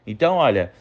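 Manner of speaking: ordinary talk, nothing marked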